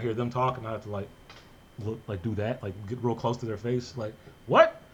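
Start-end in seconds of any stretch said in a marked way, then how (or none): 4.5-4.8 high, loud mock-baffled outburst; baffled "what?". Cousin straining to follow fast-talking relatives, loud baffled mock cry. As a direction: burst out as if you can't follow them. Acting out struggling to understand relatives.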